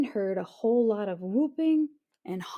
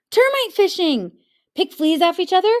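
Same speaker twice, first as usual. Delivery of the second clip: high, loud eager shouting; all clamour at once; 10 semitones higher, 10 dB louder, faster than usual. A narrator's reading. Everyone answers at once.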